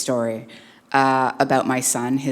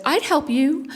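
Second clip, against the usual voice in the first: put-on much higher little-kid voice; small child's offer; 12 semitones higher, 3 dB louder than usual. Young son answering a stranger on the bus, high-pitched. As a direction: pipe up high like a five-year-old. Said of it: "And Theo said".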